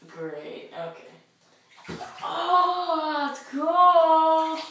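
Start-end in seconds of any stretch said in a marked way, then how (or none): 2.2-4.6 cold shock. Real, not acted. Lowering into ice bath.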